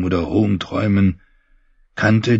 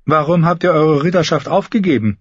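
high, raised character voice; questioning female character; 9 semitones higher, 3 dB louder, faster than usual. Acted. Brienne asking the brother about his knighthood, high questioning voice. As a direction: ask it well above the narrator's register.

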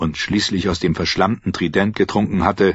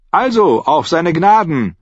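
high, loud jolly toast; cheerful call; 10 semitones higher, 5 dB louder than usual. A narrator's reading. Archer toasting his company, cheerful shout. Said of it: "rief Anguille, der Schütze, fröhlich".

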